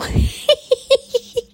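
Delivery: high-pitched giggle; delighted giggle; high laugh. Real, not acted. Laughing at herself.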